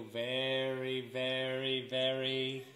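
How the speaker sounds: shrinking suspense; slowing build. A narrator's reading. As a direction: draw the words out. Red shrinking, slowed down.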